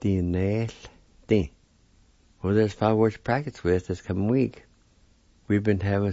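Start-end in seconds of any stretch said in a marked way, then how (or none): 0.0-1.5 Dinelti drawn out slowly. Shown as a demonstration. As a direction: say the word slowly for learners to follow. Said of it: "number five is the word play".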